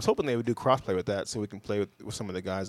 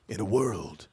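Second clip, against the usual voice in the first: movie trailer voice; 3 semitones lower, slower than usual. Acted. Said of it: "Do the movie voice again".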